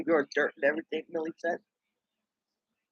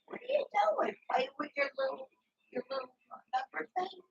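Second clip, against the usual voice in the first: high sing-song cooing to a little one; 10 dB quieter than usual. Real, not acted. Talking sweetly to the little girl in high baby talk.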